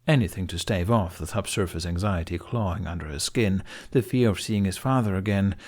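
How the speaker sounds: ordinary delivery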